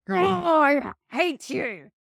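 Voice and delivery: put-on high, loud childish outburst of hate; angry child. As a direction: shout it like a furious little kid. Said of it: "they're like".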